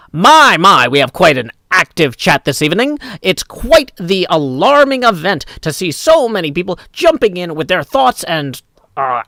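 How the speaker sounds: put-on old-time mid-Atlantic accent, swooping and emphatic. Shown as a demonstration. Streamer demonstrating the accent for chat, hammed-up mid-Atlantic. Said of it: "which one's the mid-Atlantic accent?".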